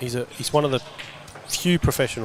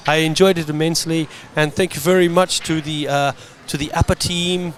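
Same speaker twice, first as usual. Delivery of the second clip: Seamus impression; 3 semitones higher than usual. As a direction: play Seamus the rich winner, smug and cheerful. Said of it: "it's not Seamus. I know it sounds like him".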